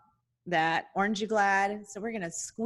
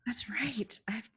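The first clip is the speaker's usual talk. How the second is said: whisper; 7 dB quieter than usual. Real, not acted. Whispered agreement.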